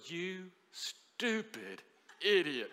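slow, drawn-out scold to himself; self-scolding. Retold or imitated. Pastor scolding himself after the blunder, slow disgust. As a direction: berate yourself under your breath. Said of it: "a little self-talk".

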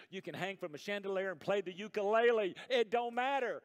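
high, loud pulpit exhortation; impassioned, insistent preacher; full-force preaching. Real, not acted. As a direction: drive the charge home to the congregation.

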